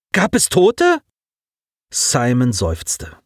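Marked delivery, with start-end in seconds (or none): 0.1-1.0 higher, alarmed small question; frightened. A narrator's reading. Colin asks Simon fearfully, higher voice. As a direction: fear creeping in. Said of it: "Collins Stimme trug einen Schrecken mit sich".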